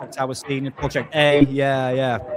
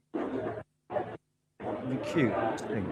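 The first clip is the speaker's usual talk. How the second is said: whispered to dog; 3 semitones lower, 9 dB quieter, much slower than usual. Real, not acted. Talking to his dog.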